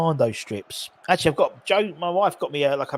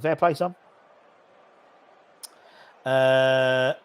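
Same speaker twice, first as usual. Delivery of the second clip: normal talking voice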